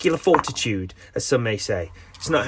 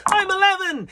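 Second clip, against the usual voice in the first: put-on much higher, excited kid voice; eleven-year-old kid; 4 dB louder than usual. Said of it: "is eleven-year-old me".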